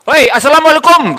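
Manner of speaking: loud, high greeting called across the street; calling out from afar. Retold or imitated. A man on the roadside shouting a greeting. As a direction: hail a passer-by from a distance. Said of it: "Seorang bapak berteriak dari jauh".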